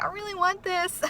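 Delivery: put-on high, soft childlike plea; pleading child. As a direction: plead like a child asking a father. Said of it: "to my father like a child".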